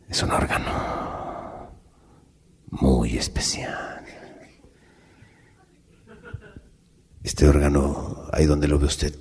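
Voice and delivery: deep grave explanation, drawn out; solemn priest. Acted. Priest explaining to the nun, low slow whisper.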